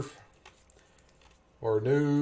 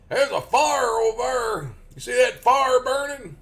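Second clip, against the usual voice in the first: high, loud, slow rural Southern holler; exaggerated Southern extra R's; 11 semitones higher, 5 dB louder, slower than usual. Shown as a demonstration. Demonstrating extra R's while pointing out a fire, loud Southern drawl. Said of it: "I did them extra R's".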